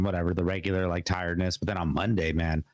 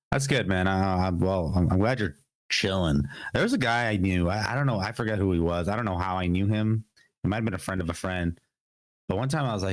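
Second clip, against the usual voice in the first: ordinary talk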